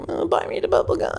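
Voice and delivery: put-on whiny kid. Kid begging parents for a bubble gun. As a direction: beg like a bratty child. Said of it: "And I was like".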